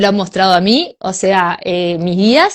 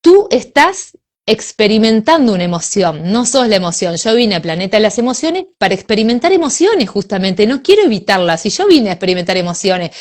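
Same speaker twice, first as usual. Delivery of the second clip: normal talking voice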